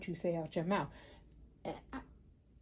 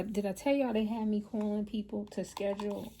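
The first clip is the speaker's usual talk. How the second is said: ordinary talk, nothing marked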